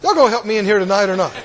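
ordinary talk

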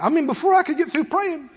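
normal talking voice

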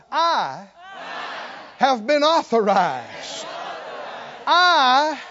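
slow, building declaration to a shout; real loud. Shown as a demonstration. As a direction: belt it out for the congregation to copy. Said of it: "say real loud".

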